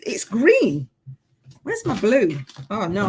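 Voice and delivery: ordinary talk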